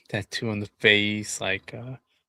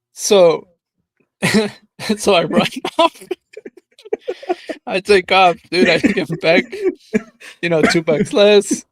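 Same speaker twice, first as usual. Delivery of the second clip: laughing; 6 semitones higher, 8 dB louder than usual. Real, not acted. Still laughing, telling ending.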